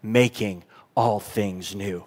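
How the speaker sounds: low, slow, hushed and grave; voice of God declaring. Acted. Preacher voicing God on the throne, hushed and grave. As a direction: breathe it out like a sacred decree. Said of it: "with the voice of the one seated on the throne declaring".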